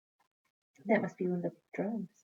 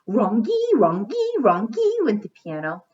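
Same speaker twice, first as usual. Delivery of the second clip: higher and louder, jangly; playful loud piano clang; loud jangling piano; 3 semitones higher, 10 dB louder than usual. Pretend. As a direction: bang it out like clashing keys. Onomatopoeia heaven, piano again.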